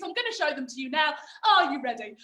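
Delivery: ordinary talk, nothing marked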